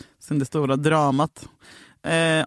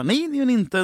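firm refusal; 8 semitones higher than usual. Retold or imitated. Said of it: "Jag bara".

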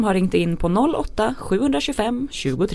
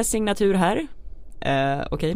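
usual speaking voice

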